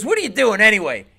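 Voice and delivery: loud, high demand; acted belligerent question; confrontational heckler. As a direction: challenge the man on stage. Bleeding heckler confronts the comic.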